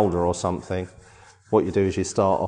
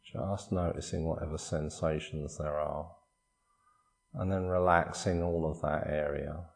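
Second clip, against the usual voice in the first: soft, unhurried body-scan voice with long pauses; guided meditation; 5 dB quieter than usual. Acted. Meditation teacher guiding listeners through a body scan, calm guided meditation. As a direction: calmly guide them through relaxing, phrase by phrase.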